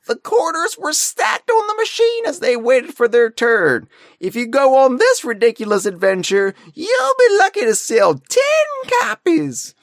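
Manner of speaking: crotchety prospector ranting about his heyday; old prospector. Acted. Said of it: "He's just a grizzled old prospector".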